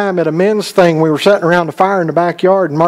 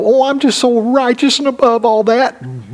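higher-pitched mimicry of the holier-than-thou; mock self-righteous; 6 semitones higher than usual. Preacher mimics self-righteous listeners, mock self-righteous. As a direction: lightly put on a pious, superior voice. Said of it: "some of you are gonna sit up and wanna go".

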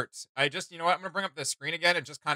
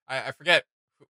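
ordinary talk

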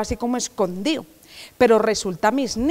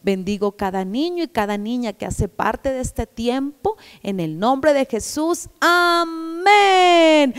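prayer; slower than usual. Acted. Closing prayer over children.

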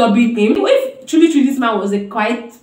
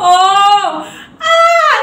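startled cry; 7 semitones higher, 5 dB louder than usual. Real, not acted. Startled at the clip.

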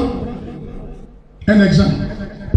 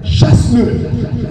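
drawn-out command; much slower than usual. Shown as a demonstration. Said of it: "Sois énervé devant lui".

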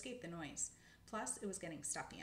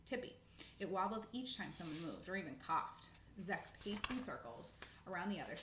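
usual speaking voice

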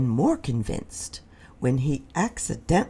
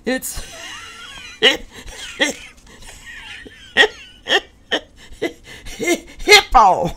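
laughing blurt; 6 dB louder than usual. Acted. Rhino blurts name while laughing.

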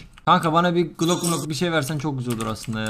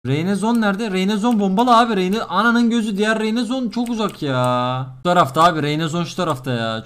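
ordinary talk

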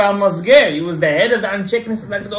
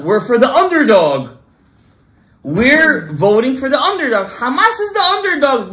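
mocking slogan; 6 semitones higher, 6 dB louder than usual. Mocking the other side's slogan.

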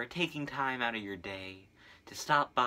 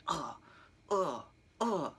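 disgusted groan; 6 semitones higher than usual. Retold or imitated. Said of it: "you know, just like".

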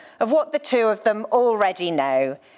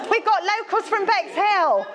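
much higher, cheering "Bexhill" to rouse a response; hyping the crowd; 10 semitones higher than usual. Real, not acted. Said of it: "you let me down".

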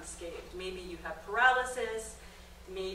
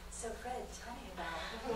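soft, hushed radio interviewer; imitating Terry Gross; 8 dB quieter than usual. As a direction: lean in like a calm public radio host. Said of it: "Terry Gross did a story".

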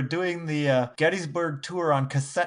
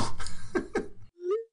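high laugh; 3 dB quieter than usual. Real, not acted. Reacting to the mock answer.

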